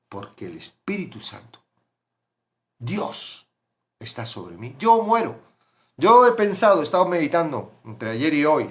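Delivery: normal talking voice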